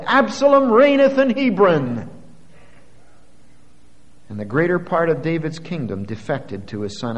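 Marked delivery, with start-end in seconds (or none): 0.0-2.1 high, loud triumphant proclamation; ringing acclamation, much higher and louder; crowd's shout. A narrator's reading. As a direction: cry it out like a crowd hailing a new king. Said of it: "and the people shouted".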